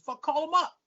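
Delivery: loud, high-pitched angry comeback; retold defiance. Retelling his answer to her police threat, defiant. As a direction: throw the threat right back.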